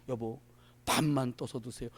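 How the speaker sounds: low, hushed request to a husband; soft wifely note. Acted. Wife leaving her husband a breakfast note, softly.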